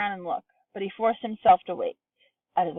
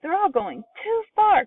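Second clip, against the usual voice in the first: put-on very high, worried boy; boy crying out in alarm; 11 semitones higher, 4 dB louder than usual. A narrator's reading. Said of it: "cried Charlie".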